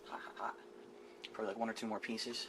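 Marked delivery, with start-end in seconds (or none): 0.1-0.5 breathy whisper from a burn. Real, not acted. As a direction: whisper it quickly under your breath. Hand burned on hot chicken.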